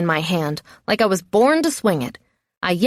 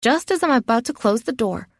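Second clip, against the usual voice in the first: plain reading voice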